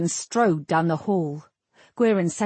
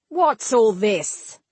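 sharp, slow, loud demand; snapped; 3 semitones higher, 4 dB louder, slower than usual. A narrator's reading. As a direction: bark it at the crowd. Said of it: "Marrowick snapped".

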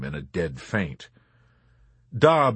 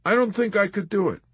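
reluctant conclusion; 12 semitones higher, faster than usual. A narrator's reading. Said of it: "concluded after weighing the idea".

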